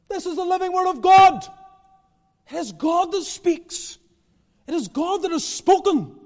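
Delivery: high, loud pulpit proclamation; ringing, emphatic proclamation; forceful preaching. Real, not acted. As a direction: declare it with full conviction, not conversationally. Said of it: "I preach in a way that is not conversational".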